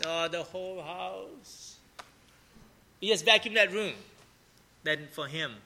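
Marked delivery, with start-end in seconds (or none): 0.0-1.8 put-on slow, soft, deflated groan of a kid; dejected nephew. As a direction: drag it out like a boy handed too big a chore. Nephew balks at whole house.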